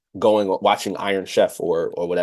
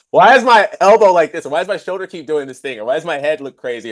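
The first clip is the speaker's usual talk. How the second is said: exasperated self-critique; 7 semitones higher, 6 dB louder than usual. Retold or imitated. Self-critique watching own video.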